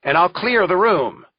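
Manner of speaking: commanding courtroom shout; judge shouting. A narrator's reading. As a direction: take control with a shouted warning. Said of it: "shouts Judge Rothstein".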